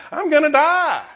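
high, loud panicked cry; mock panic. Preacher voicing Wesley terrified in the storm, high panicked yelp. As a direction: yelp it like a terrified man at sea. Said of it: "He's freaking out".

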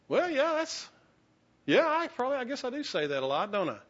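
high-pitched, hesitant retold reply; sheepish admission. Retelling his answer to a friend who called him out, sheepish. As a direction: imitate yourself caught out, voice jumping up.

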